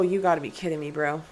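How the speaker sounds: ordinary delivery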